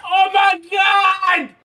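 high, drawn-out fangirl shout; excited squeal. Real, not acted. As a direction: squeal it long and high over something adorable. Squeals over a cute plush.

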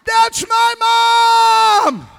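screamed at the top of his lungs, high and slow; proud kid screaming from the audience. Retold or imitated. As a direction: yell it across the hall with all you've got. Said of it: "He screamed out at the top of his lungs".

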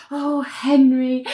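imitating a sleep-talker, high and drawn out; dreamy sleep-talk. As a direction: sigh it out as if lost in a dream. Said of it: "Bria in her sleep says something aloud".

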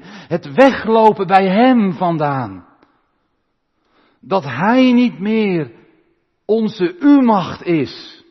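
loud, high, slow impassioned preaching; preaching at full force. Acted. Preacher to congregation about God's love, impassioned loud preaching. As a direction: pour out God's grief over the straying bride.

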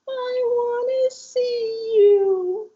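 put-on very high, slow, fragile little-girl voice; an adult daughter waking from a coma, speaking in a tiny child's voice; different voice. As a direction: stretch it out high and thin like a small child. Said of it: "her voice was a diff-, it was a different voice".

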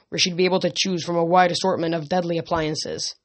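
normal talking voice

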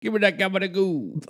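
high-pitched mock New York Italian; New York Italian accent. Co-host echoes the gabagoo line, high New York Italian accent. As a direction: squeak it out in hammed-up Italian-American. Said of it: "Italian accents are from New York".

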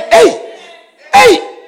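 high, loud mock calling out; mock onlooker. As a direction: call out like a gawking bystander. Mocking idle onlookers in church.

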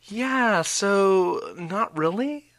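high "yeah", slow fried tail; mock eager vocal fry. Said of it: "do you like chocolate".

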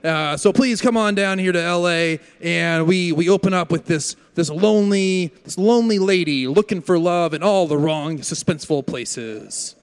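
hammed-up radio announcer welcome; radio host intro. Host welcoming listeners to the radio play, announcer voice. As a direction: sell the show like an old-time radio host.

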